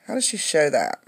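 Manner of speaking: ordinary talk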